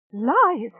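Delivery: loud shrieked accusation. Acted. Rachel shrieking at Channa. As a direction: spit it out as a shriek. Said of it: "Rachel shrieked".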